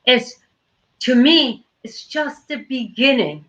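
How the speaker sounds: normal talking voice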